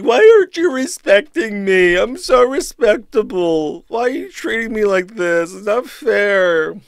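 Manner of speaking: high, whining complaint; whiny mock voice. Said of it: "this entire thing is just seething".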